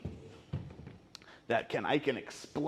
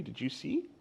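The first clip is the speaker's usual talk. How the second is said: ordinary delivery, nothing marked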